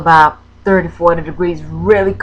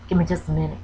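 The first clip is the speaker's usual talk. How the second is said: fighting tears; 3 semitones lower, 9 dB quieter, much faster than usual. Real, not acted. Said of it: "I'm gonna try not to cry".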